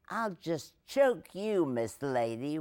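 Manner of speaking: high, slow mock threat; retold threat. Girl threatening the small child she caught, mock menacing. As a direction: imitate a child's menace, drawn out.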